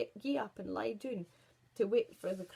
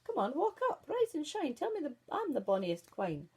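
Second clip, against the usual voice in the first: high, louder coaxing plea; coaxing queen; 6 semitones higher, 4 dB louder than usual. Acted. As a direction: wheedle the mirror into answering. Queen coaxes the silent mirror.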